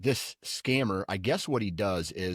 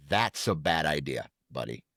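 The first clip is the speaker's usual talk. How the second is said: Liam Neeson impression; 6 semitones lower than usual. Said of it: "talking like Liam Neeson".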